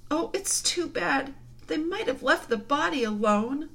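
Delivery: put-on high-pitched, aggrieved girl's cry; grieving girl. A narrator's reading. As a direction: wail in dismay. Girls grieving over missing body.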